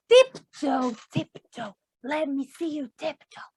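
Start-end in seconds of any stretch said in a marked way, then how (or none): none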